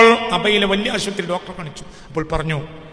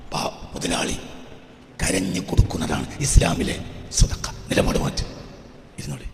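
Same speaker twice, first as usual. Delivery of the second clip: low, husky near-whisper; hushed preaching; 11 semitones lower than usual. Real, not acted. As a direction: drop to a confiding hush for the congregation. (പതിഞ്ഞ സ്വരത്തിലുള്ള ഉപദേശം)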